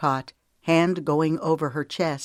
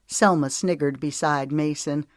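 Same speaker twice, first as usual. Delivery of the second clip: ordinary delivery, nothing marked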